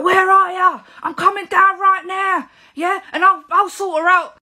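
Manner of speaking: high, loud phone yell; screaming girlfriend. Retold or imitated. Angry girlfriend on the phone threatening to come, screaming. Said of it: "his girlfriend is on the phone screaming".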